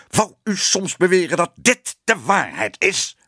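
high-pitched, outraged interruption; furious shouting. A narrator's reading. Ellemeet cutting off the editor, shouting angrily. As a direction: cut in with an incredulous, angry cry. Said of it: "schreeuwde meneer Ellemeet".

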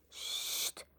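unvoiced shushing hiss; sharp whispered shush; hushing. A narrator's reading. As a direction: hush them so papa stays asleep. Sneaking away from sleeping papa.

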